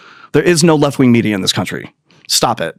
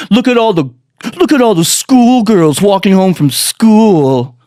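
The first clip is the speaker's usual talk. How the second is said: mock bully; 5 semitones higher, slower than usual. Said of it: "And he goes".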